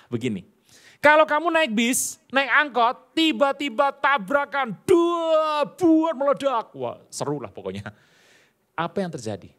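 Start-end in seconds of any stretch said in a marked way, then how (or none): 1.0-6.7 higher, louder, slowing to a drawn-out climax; dramatic build. Retold or imitated. Preacher spooks the student about a bus crash: dramatic slowing build. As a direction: paint a crash scene with mounting drama.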